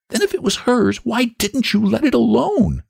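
high-pitched, outraged questioning; scandalized woman scolding a child; indignant scolding. A narrator's reading. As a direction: demand an answer, pitch held high.

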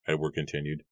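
usual speaking voice

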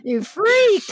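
high, loud teasing yell; mock outrage. Teasing her co-host over Emily in Paris, mock outrage.

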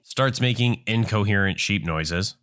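usual speaking voice

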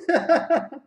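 high, loud burst of laughter. Real, not acted. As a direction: crack up at the punchline. Laughing at punchline.

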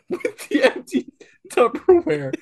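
high squeaky laughing voice; high giggling words. Real, not acted. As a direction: giggles pushing the voice up. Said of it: "I'm gonna throw up".

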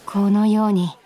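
low, slow narrator voice looking back; calm inner-monologue narration, slowed down; story narration. A narrator's reading. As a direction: tell it to the audience, slow and low. Narrator aside to listeners.

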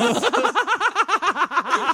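high burst of laughter. Real, not acted. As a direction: let out a squealing laugh. Big laugh at the joke.

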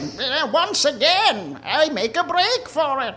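high, slow sneaky voice; sly Mister Peanut. Acted. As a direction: slink off like a sly coward. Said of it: "is like".

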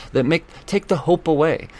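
ordinary delivery

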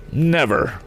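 high, emphatic denial without real volume; crying out. A narrator's reading. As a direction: cry it out in mild indignation. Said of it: "cried the inventor".

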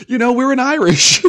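very high, loud squeal; giddy, cracking up; laughing. Real, not acted. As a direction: squeal it through real laughter. Cracks up after impression.

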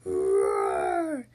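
long, loud, rolling roar; big growling storybook bear roar. A narrator's reading. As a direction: roar it out like Daddy Bear, drawn out. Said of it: "gave out a loud roar".

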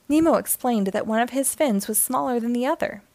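usual speaking voice

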